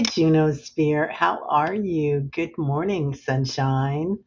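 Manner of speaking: ordinary talk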